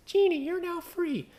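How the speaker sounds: high, slow, mocking sing-song; mocking sentimental quote; mock tribute quote. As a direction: sneer at the sappy tribute line. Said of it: "bunch of people being idiots".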